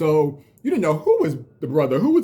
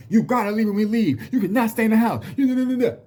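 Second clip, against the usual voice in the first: much higher, louder, scolding orders; mock the brother laying down the law; bossy brother; 10 semitones higher, 3 dB louder than usual. Imitating his brother ordering him out of the house, mock scolding. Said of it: "he was like".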